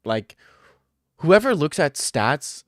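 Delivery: ordinary delivery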